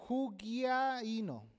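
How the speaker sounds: "hugiaino" drawn out very slowly; slow, stretched demo of the Greek word "hugiaino"; Greek pronunciation. Shown as a demonstration. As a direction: sound out "hugiaino" syllable by syllable for a class. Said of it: "En griego es".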